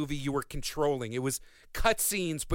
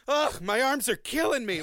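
imitated strained complaint, higher-pitched; weary grumble to himself; 7 semitones higher, 4 dB louder than usual. Game hero mid-climb to no one in particular, mock grumbling. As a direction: groan it like a climber moaning to himself. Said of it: "say to no one in particular".

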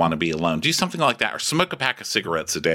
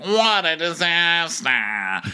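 higher and slow, drawn-out dismay; imitating Patricia; 8 semitones higher, much slower than usual. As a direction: stretch out each word like a fussy lady. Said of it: "And Patricia's like".

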